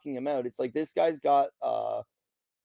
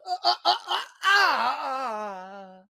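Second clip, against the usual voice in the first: mock wailing; 9 semitones higher, much slower than usual. Host mimics fighter faking pain, high hammed-up wail. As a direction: ham up a fake pained wail. Mocking fighter's fake agony.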